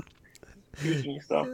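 slow whispered aside. Real, not acted. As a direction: whisper it guiltily.

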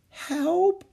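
high, feeble plea for help; weak cry. A narrator's reading. Jack helplessly watches the jaguar near Annie, weak cry. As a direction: call for help weakly. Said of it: "said Jack weakly".

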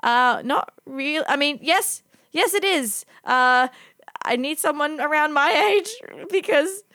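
high-pitched, halting awkward excuse; awkward honesty. Retold or imitated. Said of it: "gets really awkward".

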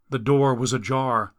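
ordinary delivery, nothing marked

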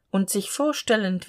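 ordinary delivery

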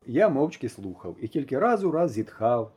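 usual speaking voice

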